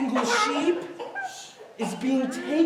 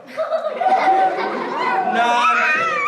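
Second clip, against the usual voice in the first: much higher, loud laughing reveal; playful squeal; 5 dB louder, much slower than usual. Real, not acted. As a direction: squeal out the joke. Playful reveal after joke.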